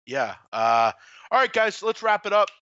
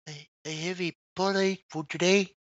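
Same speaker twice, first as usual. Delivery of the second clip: lightly put-on odd voice, a bit higher; a hint of a weird character voice; imitating Freddie's weird voice; 4 semitones higher than usual. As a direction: mimic someone's strange way of talking. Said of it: "this is how he talks".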